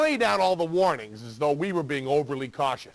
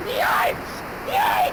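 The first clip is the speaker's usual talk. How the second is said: shrieked yikes. Acted. Fake shock scream.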